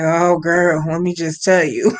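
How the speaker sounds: hammed-up deep boyfriend voice; mock low smooth-talking boyfriend. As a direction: croon it low like a teen playing grown man. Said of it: "And he's like".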